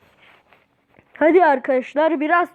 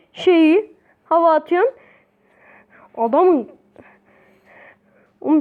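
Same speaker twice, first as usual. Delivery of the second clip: ordinary talk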